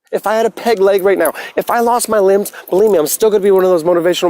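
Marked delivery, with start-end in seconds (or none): none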